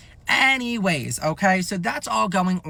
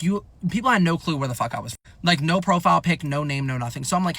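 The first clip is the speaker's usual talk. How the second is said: ordinary talk, nothing marked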